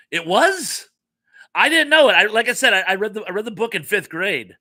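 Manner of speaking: high, loud burst of disbelief, settling as it goes; astonished. Real, not acted. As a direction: react as if this is total news. Learns the film was true.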